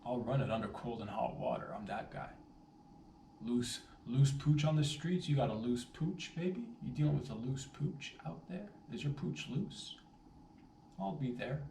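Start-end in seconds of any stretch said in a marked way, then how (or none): 3.5-11.6 hero pitch. Acted. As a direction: sell yourself like a rescue service. Hero offering help, ad-like.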